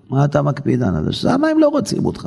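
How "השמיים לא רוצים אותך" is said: rebuke. Real, not acted. Preacher rebukes listener.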